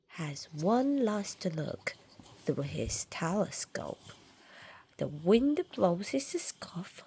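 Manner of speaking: soft secretive whisper. A narrator's reading. Reading the last look, whispered. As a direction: drop to a hush.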